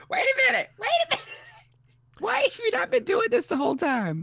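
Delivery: very high-pitched exaggerated disbelief; mock amazement. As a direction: squeak it in hammed-up astonishment. Acting out own amazed reaction.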